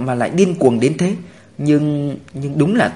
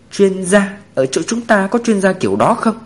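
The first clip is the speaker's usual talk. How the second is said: higher-pitched, puzzled asking; mildly taken aback, pitched up; surprised question; 6 semitones higher than usual. A narrator's reading. Tuyết Toàn asks whether they have such an expert, mildly surprised.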